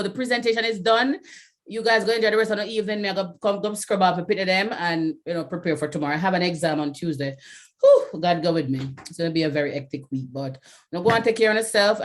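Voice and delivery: ordinary delivery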